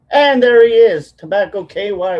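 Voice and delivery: loud, high, delighted greeting; excited greeting. Real, not acted. Greets his friend on screen.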